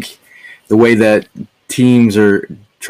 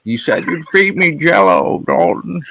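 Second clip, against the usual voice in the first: high-pitched mock pleading; imagined nursing-home resident; 7 semitones higher than usual. Old friend demanding Jell-O from Dalton, high put-on voice. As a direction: whine it like an old resident, breaking into a laugh.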